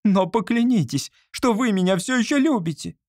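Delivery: cling to him with the demand; desperate plea. A narrator's reading. Olga demanding a vow of love, high and desperate.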